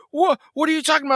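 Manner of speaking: high, shouted confusion; confused. Retold or imitated. Teenage girl confronted by a driver, confused. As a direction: protest that she has no idea. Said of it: "taken off guard".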